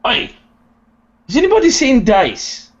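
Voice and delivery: very high, loud shout; calling out. Acted. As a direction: yell across the field. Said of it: "Durgan would say".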